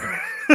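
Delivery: sudden high laugh; real high laugh; high burst of laughter. Real, not acted. Laughs at goof before Vader.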